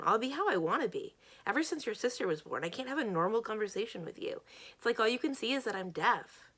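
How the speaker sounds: defiant, then pouring out grievances; angry retort. Acted. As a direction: argue back hotly at a friend. Acted quarrel, friend lashes out.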